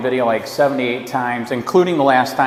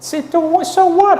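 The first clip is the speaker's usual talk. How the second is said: indignant; 4 dB louder than usual. Real, not acted. Arguing with official over trespass.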